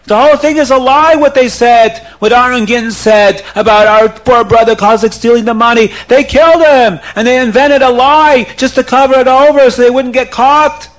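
high, loud ranting incitement; false accusation. Acted. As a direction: whip the crowd up against them.

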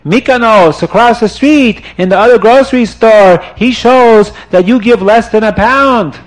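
high, loud pointing accusation; angry man pointing across the street at the cheater; loud accusing shout. Acted. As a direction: call out the real culprit.

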